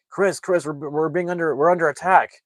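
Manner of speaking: high, loud, urgent radio alert; panicked alarm call. Retold or imitated. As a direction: call for help as if under fire. Radio alarm call during attack.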